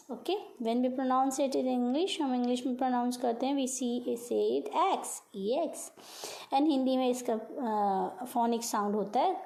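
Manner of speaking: ordinary talk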